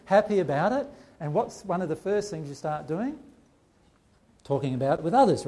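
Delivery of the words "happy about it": higher and louder, bubbling with delight; gushing convert. Retold or imitated. Mimicking a student telling everyone, gushing with joy. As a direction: gush like a thrilled new believer. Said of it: "you say".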